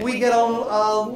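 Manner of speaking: normal talking voice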